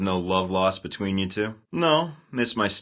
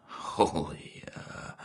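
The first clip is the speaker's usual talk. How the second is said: startled whisper; 9 dB quieter, much slower than usual. Acted. Startled under his breath.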